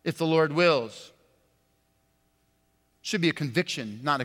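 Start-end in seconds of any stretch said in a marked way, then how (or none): 0.0-1.1 "If the Lord wills" tossed off lightly as a stock phrase; the casual throwaway "If the Lord wills"; rote cliche. Retold or imitated. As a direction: say "If the Lord wills" like an empty habit. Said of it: "I heard that a lot".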